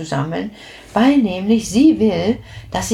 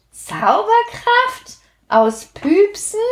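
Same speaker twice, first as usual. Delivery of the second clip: very high, slow disbelief; can't believe magic comes from farts; incredulous; 12 semitones higher, slower than usual. A narrator's reading. (ungläubig, sehr hoch gedehnt)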